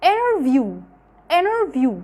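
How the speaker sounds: interview said as "innerview" with no T; American interview, silent T; t dropped. Shown as a demonstration. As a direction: drop the T after the N in interview. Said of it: "زي هنا كده".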